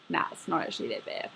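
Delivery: whispered, half to herself; whispered aside. Real, not acted. Admitting to her friend her room is fine, whispered. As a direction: drop to a whisper and take it back. Quick aside after slob joke.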